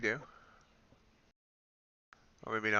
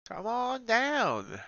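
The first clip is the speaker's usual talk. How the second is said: slow, much higher game-show-host call; 11 semitones higher, much slower than usual. Pretend. Calling the price down like a game show host, slow and high. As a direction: drawl it out like a TV host calling a contestant.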